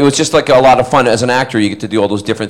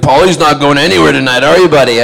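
loud, higher-pitched mock taunt; imitating a loud, ribbing pal; loud teasing; 4 semitones higher, 5 dB louder than usual. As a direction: rib a buddy at full voice. Imitates co-star teasing him about staying in.

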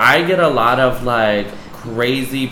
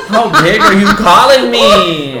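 loud, higher, through laughter; laughing mock outrage; 8 semitones higher, 7 dB louder than usual. Real, not acted. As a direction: blurt it out laughing, playfully offended. Mock offence, both laughing.

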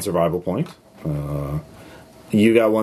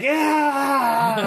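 high, drawn-out triumphant cry; villain cry. Acted. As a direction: stretch it into a gleeful yell. Villain cries out on finding.

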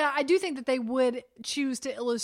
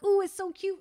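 higher-pitched imitated cooing; cute gushing; 7 semitones higher, 3 dB louder than usual. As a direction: gush as if over a baby. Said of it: "and you're like".

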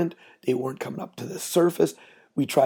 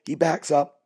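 usual speaking voice

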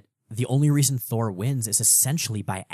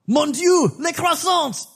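put-on high, loud hammy French accent; 6 dB louder, slower than usual. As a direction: squeal it like a cartoon French baker. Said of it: "stereotypical Frenchman".

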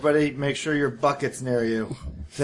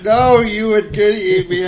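frightened prey animal; 6 semitones higher, 6 dB louder than usual. Acted. Prey keeps accusing monster.

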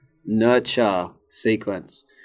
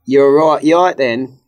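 loud, higher mock English passer-by; English greeting; 4 semitones higher, 8 dB louder, slower than usual. Mimicking English people greeting in passing, loud and higher. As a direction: toss off a breezy English hello while walking past. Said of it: "English people say".

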